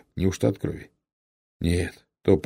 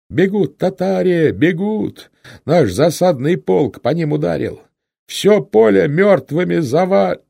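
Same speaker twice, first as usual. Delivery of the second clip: high, loud triumphant shouts; loud, drawn-out battlefield cry; yelling; 10 semitones higher, 7 dB louder, slower than usual. A narrator's reading. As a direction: holler the news from a galloping horse. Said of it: "что-то орал".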